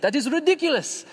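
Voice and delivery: a quick, high mock Indian accent on the quote; light Indian accent, half done; imitating an Indian accent. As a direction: slip into an Indian accent for one line, then drop it. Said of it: "I'm not gonna try to do the accent".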